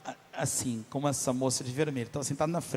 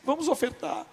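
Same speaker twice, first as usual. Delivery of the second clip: higher, slow, through tears; tearful plea; 9 semitones higher, slower than usual. Retold or imitated. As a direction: imitate the wife pleading while crying. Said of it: "Minha esposa chorando". (suplicando chorosa, voz aguda e lenta)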